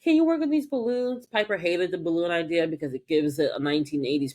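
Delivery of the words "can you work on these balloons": chirpy coworker. Retold or imitated. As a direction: ask sweetly and fast. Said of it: "she was like".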